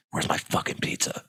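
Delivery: breathy menacing whisper of Alec Baldwin; mock-menacing hushed rasp; Alec Baldwin impression. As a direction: hiss it like an angry movie star. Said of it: "It's Alec Baldwin".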